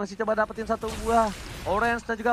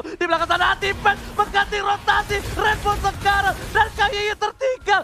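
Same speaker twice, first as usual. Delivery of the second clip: higher and louder hype call; rising excited call; 8 semitones higher, 5 dB louder than usual. Real, not acted. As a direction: build the tension of the fight. Caster hypes close fight. (seruan caster makin heboh)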